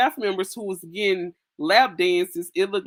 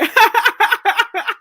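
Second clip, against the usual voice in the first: high shrieking laugh; 8 dB louder than usual. Real, not acted. Cracking up at the comment.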